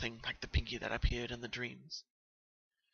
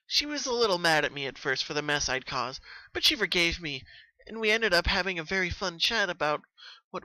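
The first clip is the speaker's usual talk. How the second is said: higher, louder bubbly pony voice; Pinky, subdued; 6 semitones higher, 4 dB louder than usual. Acted. Pinky telling Twilight when the dreams began. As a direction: explain it eagerly, a little worn.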